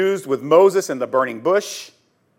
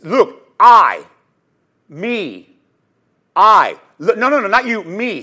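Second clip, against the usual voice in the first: insistent "I", loud and separated by pauses; emphatic self-reference; 5 dB louder than usual. Shown as a demonstration. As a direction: stress on "I" and "me", with "I" punched loud. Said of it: "In other words, it's for emphasis".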